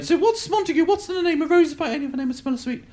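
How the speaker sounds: very high, rattled-off Juliet recital; fluent girl Juliet. Acted. Classmate reading Juliet perfectly, high and fluent. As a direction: breeze through Shakespeare in a high girlish voice. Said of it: "she's going".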